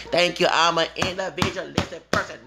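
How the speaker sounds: ordinary talk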